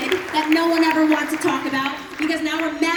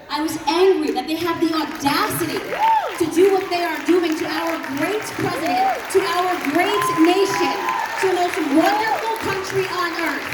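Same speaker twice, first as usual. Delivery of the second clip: indignant anger; 5 semitones higher, 3 dB louder than usual. Real, not acted. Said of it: "I was immediately furious".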